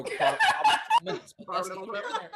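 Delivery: laughing. Real, not acted. Laughing at own stumble.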